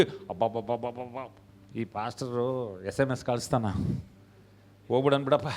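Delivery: low, soft, weary complaint; mock grumbling. Member complains about the pastor's messages, mock grumbling. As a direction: grumble under your breath, imitating a reluctant member.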